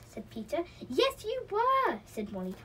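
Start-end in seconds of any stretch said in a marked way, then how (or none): 0.9-2.0 high, loud, drawn-out contradiction; raised-pitch childish argument, slow and loud; insistent retort. A narrator's reading. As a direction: stretch it out insistently in a squabble. Escalating acted children's quarrel.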